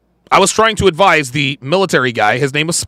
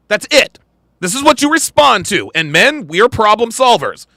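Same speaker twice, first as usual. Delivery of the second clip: ordinary talk, nothing marked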